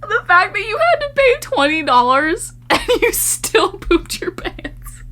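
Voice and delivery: high voice breaking into laughter; laughing disbelief. Real, not acted. Reacting to the viewer who still pooped, laughing. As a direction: squeeze the words out mid-laugh.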